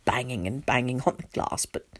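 low, soft, numb telling; subdued story narration. A narrator's reading. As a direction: narrate it hushed and low, as if in shock. Numb narrator tells of vulture.

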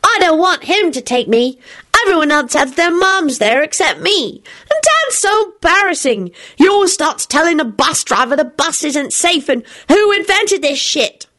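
put-on high-pitched, embarrassed boy's complaint; complaining child. A narrator's reading. Teddy grumbling about embarrassing Dad, complaining. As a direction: complain high, drawing out the ending. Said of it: "Teddy sulked".

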